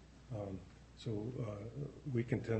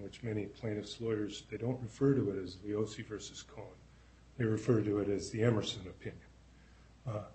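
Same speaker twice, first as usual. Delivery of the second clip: ordinary talk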